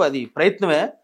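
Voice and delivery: ordinary talk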